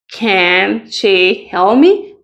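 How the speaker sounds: "Can she help me?" drawn out slowly with a rise on "me"; slow model question. Shown as a demonstration. Teacher modelling a question slowly for students. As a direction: ask it slowly, rising on "me". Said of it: "cómo sería una oración en pregunta".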